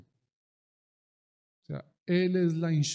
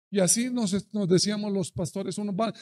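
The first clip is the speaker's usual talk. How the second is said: ordinary talk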